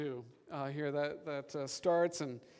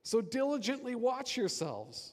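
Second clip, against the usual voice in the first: higher-pitched, urgent scripture reading; emphatic exhortation; 6 semitones higher than usual. Acted. Preacher showing the congregation the verse's emphasis, raised exhortation. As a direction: read it as if it ends in an exclamation point. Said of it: "they use exclamation points".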